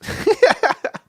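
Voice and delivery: squeaky high-pitched laugh; high giggle. Real, not acted. Laughs at own joke.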